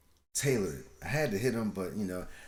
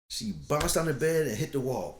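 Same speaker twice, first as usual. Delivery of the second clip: ordinary delivery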